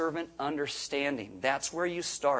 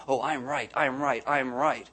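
mock stubborn insisting; 4 semitones lower, slower than usual. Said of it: "trying to prove our own rightness".

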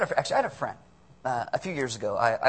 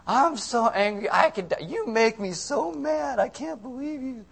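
put-on high, melodramatic teen girl tantrum; hammed-up, drawn-out teenage fury; junior high girl; 7 semitones higher, 5 dB louder, slower than usual. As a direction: wail it like an outraged schoolgirl. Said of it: "junior high girl".

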